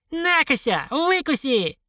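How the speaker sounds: high-pitched taunting parting shot; defiant old woman. A narrator's reading. Old woman leaving the world behind, defiant taunt. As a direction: taunt them in a high, triumphant voice.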